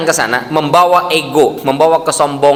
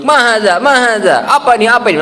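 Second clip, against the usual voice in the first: higher, louder imitated challenge; mock alarmed questioning; 6 semitones higher, 3 dB louder than usual. Onlooker pointing at a pilgrim's tattoos, mock alarmed. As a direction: play an onlooker demanding to know what this is.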